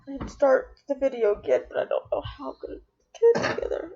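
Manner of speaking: high, tearful and strained; pleading through tears; crying. Real, not acted. Crying, trying to compose herself.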